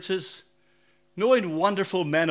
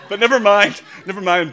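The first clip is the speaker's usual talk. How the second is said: laughing it off; 4 semitones higher, 15 dB louder than usual. Real, not acted. Laughing at wife's joke.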